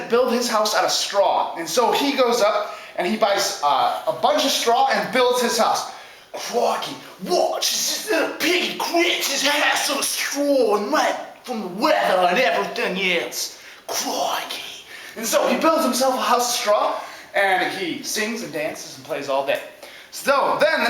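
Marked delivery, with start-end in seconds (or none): 6.3-14.8 drawn-out Aussie nature-host voice; slow, showy Aussie piggy; Australian-style first little pig. Acted. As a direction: play the pig as an Aussie adventurer.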